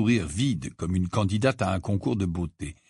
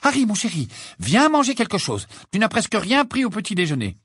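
high, motherly character voice; high-pitched, insistent fondness; fussing mother; 10 semitones higher, 4 dB louder than usual. Acted. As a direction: coax a dear child to eat. Mrs Weasley fussing over Harry's meal.

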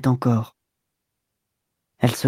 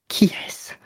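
quavering, near sobbing; 4 dB quieter than usual. Acted. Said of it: "avec ce ton chevrotant des femmes qui vont sangloter".

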